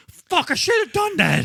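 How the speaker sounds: high furious yell; angry shout. Retold or imitated. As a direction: yell it in a tantrum. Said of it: "he's like".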